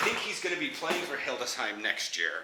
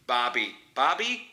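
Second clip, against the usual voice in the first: trying out an Australian "Barbie" with no r; tentative Australian pronunciation, second try rising; Australian accent attempt. Shown as a demonstration. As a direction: test the Australian way of saying Barbie. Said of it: "Australian accent".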